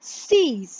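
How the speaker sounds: "सीस" said as French six. Shown as a demonstration. Teacher modelling six for the class. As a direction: say "सीस" with a clear s at the end. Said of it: "six is C and S".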